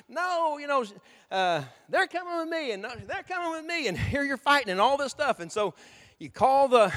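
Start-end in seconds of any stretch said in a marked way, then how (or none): 0.1-3.9 high, pleading mock shout; mock yelling. Parent quarreling over custody, high mock shout. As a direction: squabble like a parent grabbing the kids. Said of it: "you're hollering, you're yelling, you're shouting".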